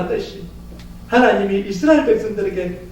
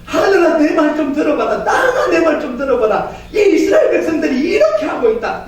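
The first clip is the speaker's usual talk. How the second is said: God complaining to heaven and earth about Israel, exasperated; God pleading in plain words; 5 semitones higher than usual. Acted. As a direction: complain to the sky like a fed-up parent. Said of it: "지금 뭐예요".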